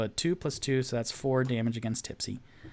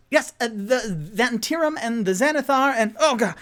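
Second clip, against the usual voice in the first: higher, louder fumbling voice; Rock flustered; 10 semitones higher, 6 dB louder than usual. Acted. Rock repeating the guild names, flustered. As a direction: stammer it as things go wrong. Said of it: "Rock goes".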